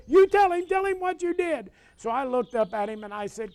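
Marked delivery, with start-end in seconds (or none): 0.1-1.7 retold hollering mother. Mother scolding her son in front of the grocer, high hollering. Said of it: "Mom, Mom's hollering".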